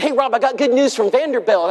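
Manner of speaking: high, eager retold voice; excited good news. Wife tells preacher of news from Vanderbilt, excited.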